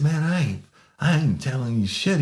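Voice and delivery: high-pitched imitation of anxious backing down; mock pleading. Imitating subdued man backing down, pleading.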